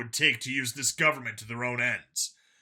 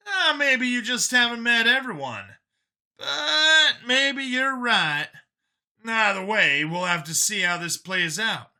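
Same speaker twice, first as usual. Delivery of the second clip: higher, lighter voice for Brian; Brian's voice; 6 semitones higher, 4 dB louder than usual. A narrator's reading. Brian pushing back at Vincent, higher voiced. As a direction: voice the younger friend Brian, a bit higher. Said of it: "Brian speaking in story".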